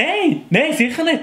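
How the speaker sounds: hasty denial. Acted. Denying to girlfriend.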